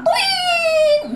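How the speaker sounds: high cartoon "twing" zip; a playful flying sound effect; mock takeoff noise; flying whoosh.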